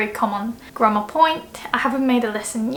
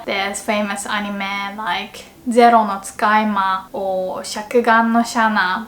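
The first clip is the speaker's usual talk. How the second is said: ordinary delivery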